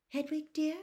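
distracted. A narrator's reading. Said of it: "said Missus Weasley distractedly".

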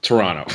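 "Toronto" as "Toronno", low with a laugh; second T dropped. Shown as a demonstration. Co-host hammers home the local "Toronto", second T dropped. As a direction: say "Toronto" minus the second T, amused. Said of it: "you don't pronounce the second T".

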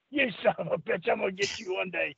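low, quiet mock threat; retold seething threat. Fuming promoter to the rehired wrestler, retold low seething threat. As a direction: imitate a fuming promoter, voice dropped. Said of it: "smoke was coming out".

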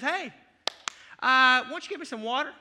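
loud, fast, offhand demand; brusque Elijah. Retold or imitated. Preacher voicing Elijah brusquely ordering the widow. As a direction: barge in and demand water casually. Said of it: "And just says".

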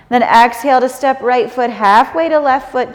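ordinary talk

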